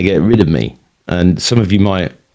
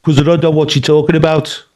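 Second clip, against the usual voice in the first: imitated Brummie accent, pitched up; deep Birmingham accent; 8 semitones higher than usual. Brother explains the Facebook defriending, imitated deep Birmingham accent. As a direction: put on a thick Birmingham accent. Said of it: "in a deep Birmingham accent".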